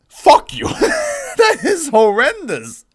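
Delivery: loud outburst breaking into high-pitched laughter; curse, then protest in laughing outrage; real indignation, high and drawn out; heated. Real, not acted. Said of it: "I'm heated".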